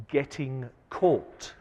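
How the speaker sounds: getting caught drawn out slow and leaned on; stress on getting caught. Real, not acted. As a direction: hit the words getting caught, slowly. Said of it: "And I emphasize the word getting caught".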